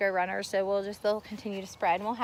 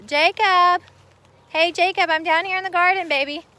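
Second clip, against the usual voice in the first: loud, higher call across the yard; 9 semitones higher, 11 dB louder than usual. Real, not acted. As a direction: shout so Jacob hears her far off. Calling out to distant son.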